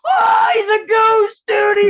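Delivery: very high, slow, loud mock yell; spooked friend. Friend shouting at the teller waking up, spooked. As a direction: scream it like you saw a ghost. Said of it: "And he's like".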